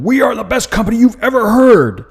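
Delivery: loud hammed-up corporate bragging; boastful shout. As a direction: shout it like a company showing off. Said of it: "it's not screaming at you. It's not boasting".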